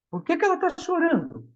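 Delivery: put-on gently asking the children what happened; teacher asking. Teacher questioning a crying child's classmate, high and quick. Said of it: "O menino falou assim".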